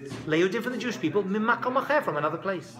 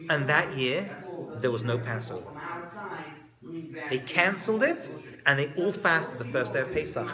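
usual speaking voice

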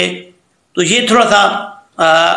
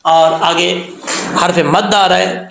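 ordinary talk, nothing marked

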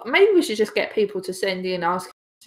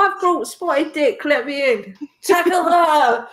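higher, slow, hammed-up British; mock British accent; 5 semitones higher than usual. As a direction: act out a silly British gate scene. Acts out scene at palace gates.

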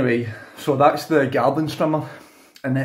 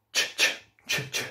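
soft breathy ch ch ch, fading softer; unvoiced ch sounds; 7 dB quieter than usual. Shown as a demonstration. Showing the ch sound hidden in chit chat, soft voiceless ch bursts. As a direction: push air through quiet ch bursts. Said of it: "You get that ch".

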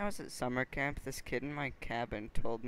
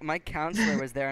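ordinary talk, nothing marked

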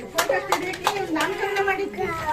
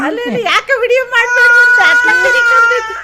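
loud call over crying; 6 semitones higher, 10 dB louder than usual. Real, not acted. Mother calling over her crying baby, raised and high.

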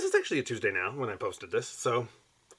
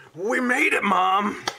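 much higher and louder, celebrating to Mom; mock triumphant cheer; 9 semitones higher, 6 dB louder, much slower than usual. Real, not acted. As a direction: shout it like a proud win, tongue in cheek. Vlogger celebrates being starving artist.

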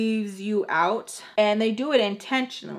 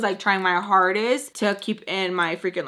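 usual speaking voice

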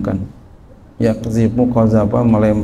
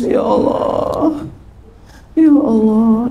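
much higher, breaking, tearful plea to God; mock weeping cry of Ya Allah; imitated crying prayer; 10 semitones higher, much slower than usual. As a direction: act out someone sobbing in prayer. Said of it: "Sampai nangis-nangis".

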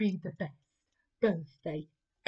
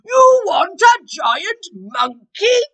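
loud, high, slow outraged question; raging duke; 12 semitones higher, 17 dB louder, slower than usual. Acted. Duke raging at the queen's wish: loud, slow, high. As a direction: explode in disbelief. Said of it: "before the duke raged".

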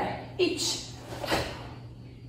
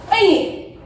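sharp, high, loud "I" from the belly; kiai shout; 6 semitones higher, 5 dB louder than usual. Shown as a demonstration. Karate teacher showing the class the kiai, a loud high yell. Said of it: "fazer o grito".

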